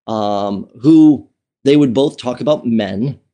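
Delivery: usual speaking voice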